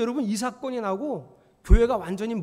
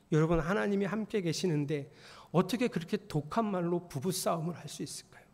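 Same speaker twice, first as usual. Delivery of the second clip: usual speaking voice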